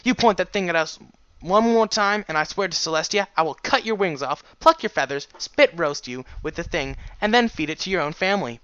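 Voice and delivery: furious threat, high and loud; pissed off. A narrator's reading. Furious pony threatens the griffin, loud and angry. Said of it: "and she was pissed".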